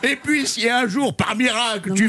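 put-on slow, grumbling old grandpa; lightly voiced cranky grandpa; grumpy grandpa voice. Grandpa answers back in voice.

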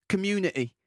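popular British accent, T dropped from "Community"; casual British street accent, T left out; working-class British accent. Shown as a demonstration. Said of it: "avec un accent populaire".

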